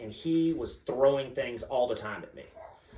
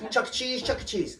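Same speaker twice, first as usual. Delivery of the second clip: put-on high, relentless kid chant; excited little daughter; 10 semitones higher than usual. Daughter begs dad all day, excited child voice. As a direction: imitate a pestering child in a high voice.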